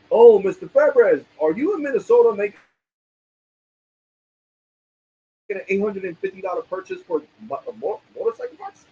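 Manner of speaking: high, put-on customer-service voice; imagined bank caller. Bank agent checking a purchase, mock-concerned.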